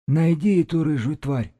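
high-pitched eerie shaman, scraping on the nerves; high, grating sorcerer. A narrator's reading. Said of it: "Голос шамана скрёб по нервам, как металл по стеклу".

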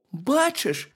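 high-pitched hedgehog question; hedgehog character. A narrator's reading. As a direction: ask it high, as the little hedgehog. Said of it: "сказав їжачок".

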